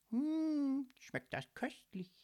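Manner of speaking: savouring hum, then hushed with relish; smacking lips. A narrator's reading. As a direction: talk while munching something delicious. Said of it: "sagte sie schmatzend".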